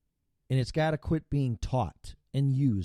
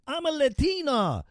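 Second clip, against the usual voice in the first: high mocking voice; high-pitched mocking imitation; mock whiny quote. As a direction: sneer it like a mock complaint. Mocking someone playing identity card.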